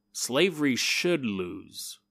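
slow, low, dramatic declaration; grave hero line. Pretend. Hero on his plantation answering the warning, slow grave declaration. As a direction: deliver it like the big trailer moment. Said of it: "he turns and he goes".

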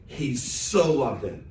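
"so" pressed, slow and heavy; emphatic "so", slowed down; stress on so. Shown as a demonstration. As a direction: lean into "so" in "he so loved it". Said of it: "It's emphatic in the text".

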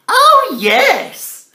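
high, loud eager yes; enthusiastic. Acted. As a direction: burst out with delight. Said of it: "He says it enthusiastically".

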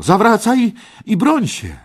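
very high, loud battle challenge; challenging shout. Acted. Parsival challenges the red knight, shouting high. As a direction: yell a demand to turn and fight. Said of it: "krzyknął Parsival".

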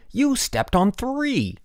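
high, drawn-out accusation; indignant retort. A narrator's reading. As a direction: throw the blame back slowly. Boy retorts in ant quarrel.

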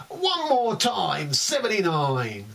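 high slow bingo call; bingo caller. Pretend. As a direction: sing out the number to the hall. Bingo calls in poem.

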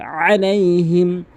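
chanted আলাইহিম opening on squeezed throat ayn; weighted ayn. Shown as a demonstration. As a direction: lean on the first sound of আলাইহিম. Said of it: "ভর দিয়ে বলতে হবে". (আলাইহিম-এ আইনে ভর দিয়ে)

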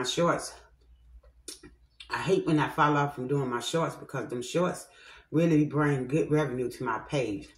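talking with food in mouth; eating between words; chewing while talking. Real, not acted. Seen 0: Spoons oatmeal into mouth at +2.3, +6.8; talks between bites.

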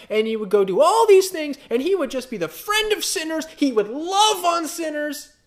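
high, loud, gushing mock objector; exaggerated high-pitched sing-out, sarcastic; mocking parody. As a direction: parody a naive believer at full volume. Parody of people's nice-Jesus argument.

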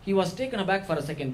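ordinary delivery

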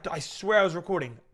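normal talking voice

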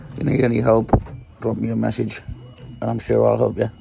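usual speaking voice

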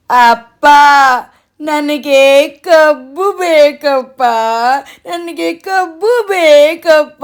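put-on high, slow whiny toddler wail; hammed-up bawling child voice; crying child. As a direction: drag out each word like a sobbing child. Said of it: "ಮಗು ಥರ ಆಗಿ ಉರುಳಾಡ್ಕೊಂಡು ಅಳಕ್ ಶುರು ಮಾಡ್ತಾನೆ".

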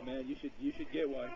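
normal talking voice

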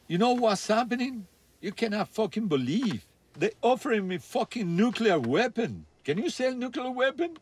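excited retelling. Retold or imitated. [name] retelling Tarzan's sauna news, excited and high. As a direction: retell his disbelieving excitement. Tarzan quoted offering nuclear weapons.

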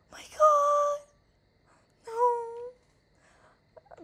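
very high, frightened wail; real high-pitched distress; shaken fright. Real, not acted. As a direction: wail it high after a bad scare. Shaken after the jump scare.

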